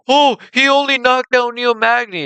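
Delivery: high, whiny mock voice of a dismissive fan. Mocking fans who dismiss Prates, high whiny voice. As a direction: sneer it like a know-it-all fan. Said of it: "They're like".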